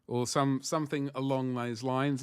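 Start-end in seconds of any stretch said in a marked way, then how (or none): none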